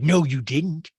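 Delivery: loud put-on retort; Rinna. Housewife contradicting Sutton, loud imitation. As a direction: mimic a flat-out loud denial. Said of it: "And Rena's like".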